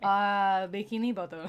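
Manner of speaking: loud, hammed-up French-accented cartoon narrator; mock-French narration, breaking into a laugh. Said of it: "start in the SpongeBob narration".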